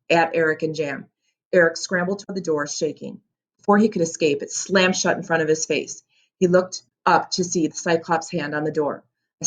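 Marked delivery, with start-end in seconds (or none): none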